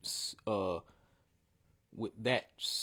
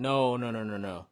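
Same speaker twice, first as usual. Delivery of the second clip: ordinary talk, nothing marked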